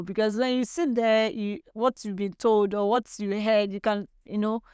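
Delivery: usual speaking voice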